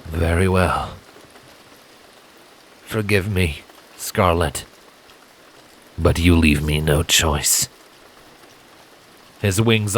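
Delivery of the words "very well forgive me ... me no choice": lightly voiced stern assassin, lower and regretful; disappointed resolve. Silent tells Scarlet he must fight her, disappointed. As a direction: sigh it out, sorry but decided. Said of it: "disappointed sigh".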